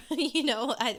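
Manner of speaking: amused, laughing lift in the voice. Real, not acted. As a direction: laugh as you say it. Amused at own bomb-game comparison.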